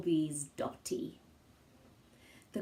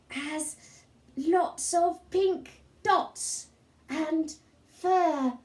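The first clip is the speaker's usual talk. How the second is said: put-on a small boy spinning a tale; little boy Sid; 3 semitones higher than usual. Sid describes imaginary Kevin.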